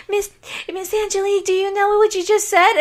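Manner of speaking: high mock boy's voice asking; imitating a young boy. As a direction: play the teenage boy, amused. Boy voiced in retold story.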